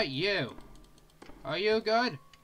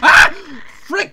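real startled high shriek; scream, then curse loud and high; sudden jump-scare yelp; 9 semitones higher, 16 dB louder than usual. Real, not acted. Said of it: "Why do I keep screaming?".

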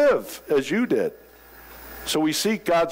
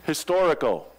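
usual speaking voice

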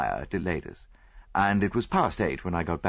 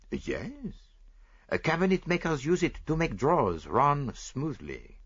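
put-on fussy Belgian detective, lightly French-accented; lightly voiced proud Belgian sleuth; Poirot voice; 7 semitones higher than usual. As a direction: play the vain little detective sure of himself. Poirot explains.

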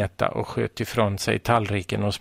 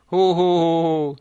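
high, loud ho-ho laughing; a girl failing to hold back her laughter; howling laughter; 7 semitones higher, 6 dB louder than usual. A narrator's reading. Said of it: "tjöt en annan flicka". (tjutande skratt, högt och ljust)

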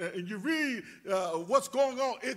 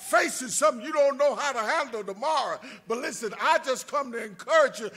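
preaching lift; 2 semitones higher, 4 dB louder than usual. Acted. Preacher encouraging the congregation.

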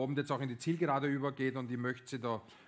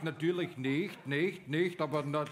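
ordinary talk, nothing marked

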